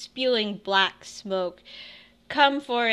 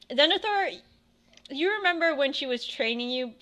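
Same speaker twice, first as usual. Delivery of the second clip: ordinary talk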